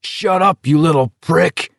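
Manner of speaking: high angry yell. Acted. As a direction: snap at him furiously. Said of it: "Abraham yelled".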